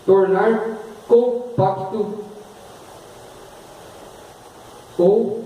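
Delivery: slow dictation with pauses; dictating. Shown as a demonstration. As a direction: dictate word by word. Dictating definition.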